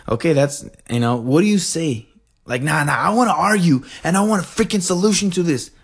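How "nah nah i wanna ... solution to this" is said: pitched-up, playful protest; hammed-up customer complaint, higher; mock argumentative. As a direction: refuse and demand in mock frustration. Acts out wanting an argument.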